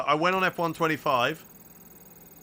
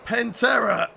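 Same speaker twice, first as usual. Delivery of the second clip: shout; 8 semitones higher, 5 dB louder, slower than usual. Real, not acted. Shouted name.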